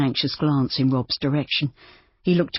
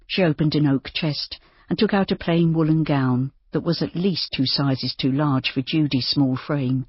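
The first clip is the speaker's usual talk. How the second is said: ordinary delivery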